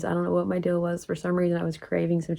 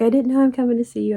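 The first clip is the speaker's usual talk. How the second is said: fast, high, louder gush; retold excitement; 5 semitones higher, 6 dB louder, faster than usual. Telling her best friend about the surprise visit, excited. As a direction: rush it out in mock excitement. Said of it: "she was excited, just as excited as I was".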